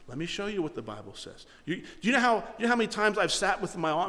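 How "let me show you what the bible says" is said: soft, patient offer; quieter, kind teacher voice; gentle teaching. Retold or imitated. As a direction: invite them in calmly. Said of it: "Long suffering is you continue to teach".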